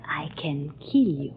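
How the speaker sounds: breathy low threat, barely voiced; menacing whisper. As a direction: hiss the threat close and quiet.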